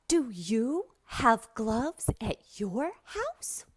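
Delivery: slow, lilting teacher question; slow question to child. Shown as a demonstration. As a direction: ask a beginner word by word. Asks imagined young student.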